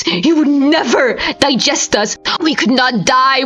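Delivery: plain reading voice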